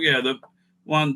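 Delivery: ordinary delivery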